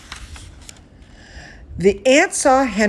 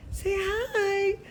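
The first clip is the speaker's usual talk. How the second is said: coaxing pet talk; 11 semitones higher, 8 dB quieter than usual. Real, not acted. Teacher coaxing her dog Chester to greet Kathy, high soft coo. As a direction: coo it to the pup.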